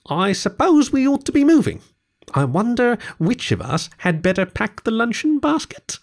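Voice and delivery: high, musing character voice; Rat winding things up. Acted. Rat ready to leave the picnic, high hinting musing.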